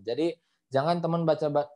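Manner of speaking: usual speaking voice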